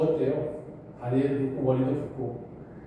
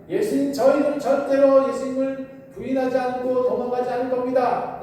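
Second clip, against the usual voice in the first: boastful vow; 8 semitones higher than usual. A narrator's reading. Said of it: "호언장담을 해버렸어요".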